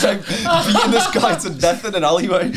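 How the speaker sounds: laughing while describing the fight; words breaking into laughter. Real, not acted. Lad laughing as he tells the story.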